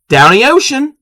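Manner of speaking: Maryland accent. Shown as a demonstration. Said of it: "we, we say".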